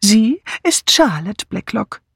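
"Sie" and "Charlotte" drawn out, pitched high; slow right down and lift "Charlotte"; emphatic revelation. A narrator's reading. Old sleuth unmasking the murderess, slow stress on "Charlotte". Sleuth insists on the true name.

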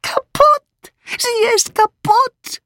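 very high, drawn-out lament; outraged wail. A narrator's reading. Mitzi lamenting her ruined pan, outraged. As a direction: shriek it slowly in outrage.

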